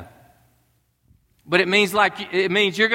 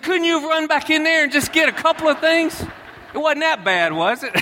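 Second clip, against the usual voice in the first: high, joking whine to the son; mock pleading; 9 semitones higher than usual. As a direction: plead half-seriously for the glove. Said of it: "I said".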